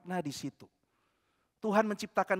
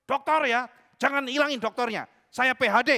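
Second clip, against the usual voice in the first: plus aigu et plus fort, exigeant; réclamer son titre avec fierté; insistance hautaine; 6 semitones higher, 8 dB louder than usual. Retold or imitated. Pria menuntut gelar doktor.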